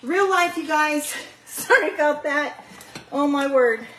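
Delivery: panting after running upstairs; breathless, still catching her breath. Real, not acted. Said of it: "Whew, I gotta catch my breath".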